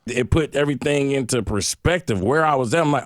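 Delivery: ordinary talk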